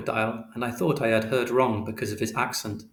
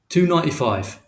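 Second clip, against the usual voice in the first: short, louder shopkeeper reply; curt shopkeeper; 5 semitones higher, 5 dB louder than usual. A narrator's reading. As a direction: snap the price back. Shopkeeper answers curtly.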